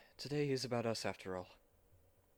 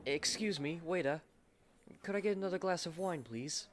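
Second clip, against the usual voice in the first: calling the waiter; 7 semitones higher than usual. Acted.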